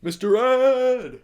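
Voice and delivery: drawn-out loud cry of the name; excited shout. Real, not acted. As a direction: yell it like a big reveal.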